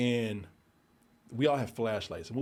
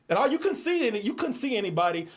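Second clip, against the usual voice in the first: usual speaking voice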